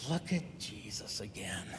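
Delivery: whispered disapproving aside; a gossiping whisper; Pharisees muttering. Acted. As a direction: hiss it so only your neighbour hears. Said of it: "the Pharisees are muttering".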